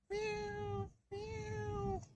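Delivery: very high, soft meows; faint meowing. Acted. Trapped cat meowing faintly, very high. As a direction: mew softly, far off.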